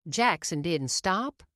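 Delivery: usual speaking voice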